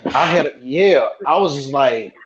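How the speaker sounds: usual speaking voice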